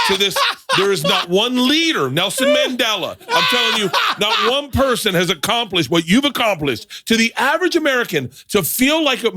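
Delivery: excited shout. Real, not acted. Over-the-top rave, shouting, laughing.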